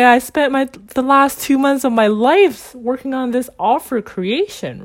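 high, loud, exasperated complaint; retold anger. Venting over two wasted months of work, high retold angry complaint. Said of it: "I was filled with anger".

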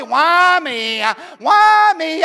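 Eeyore spirit. Retold or imitated. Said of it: "I call that the Eeyore spirit".

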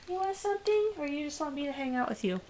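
talking to a pet. Real, not acted. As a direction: coo softly at the cat. Talking to the cat.

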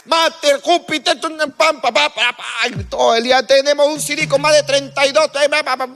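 mocking classmates. Classmates babbling technical jargon.

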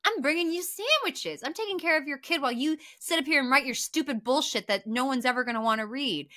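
high, loud fast telling-off; pretend angry pushback. What the wife should say to her husband, loud and fast. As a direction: snap back at him, fed up. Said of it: "this is gonna be like".

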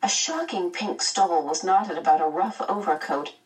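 plain reading voice

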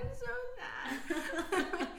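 high, drawn-out wail through laughter; mock crying. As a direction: imitate your own hysterical crying, stretched and high. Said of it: "I just started hysterically crying".

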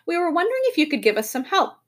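high, quick, cheerful request; bright request. A narrator's reading. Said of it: "said the professor brightly".

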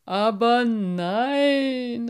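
moved carp calling out slowly; touched exclamation. A narrator's reading. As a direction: stretch it out, overcome and delighted. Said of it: "rief Cyprinius gerührt".

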